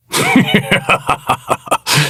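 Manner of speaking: very high unhinged cackle; shrieking triumphant villain laugh; maniacal laugh. Acted. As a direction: laugh like a mad god. All obstacles eradicated.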